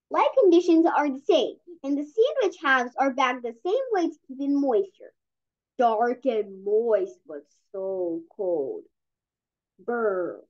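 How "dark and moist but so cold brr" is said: slow, low shivering critter voice; a pet hamster chilled in the freezer, dragging the words slow; lightly voiced cold hamster.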